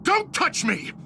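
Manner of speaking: high, sharp cry of betrayal; furious shout. Acted. Betrayed friend to his rival, furious high shout. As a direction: scream at him to keep his hands off.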